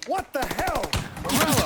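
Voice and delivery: loud, angry cry of a name; furious shout. Acted. Boss yelling at his enemy, furious shout. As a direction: spit the name out in rage.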